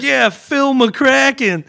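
high, loud, slow prank reply; cheeky fake name. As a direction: drag out the fake name smugly. Kid's prank name answer.

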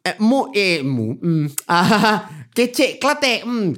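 halting mock Kelantanese Malay accent; put-on Kelantanese lilt with little grunts. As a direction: play a local in a Kelantanese accent. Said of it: "Kelantanese guy".

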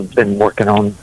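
ordinary talk

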